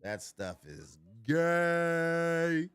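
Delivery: slow, high mocking drawl; mock drawn-out jeer. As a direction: stretch it out teasingly. Mocking air fryer fan.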